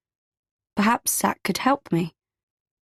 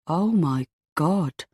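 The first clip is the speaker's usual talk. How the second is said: stunned; much slower than usual. A narrator's reading. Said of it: "his jaw literally drops".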